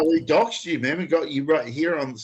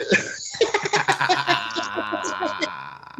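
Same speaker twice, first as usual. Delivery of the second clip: a high, airless wheeze of laughter; breathy wheezing laugh. Real, not acted. Host wheezing with laughter at the prank call story.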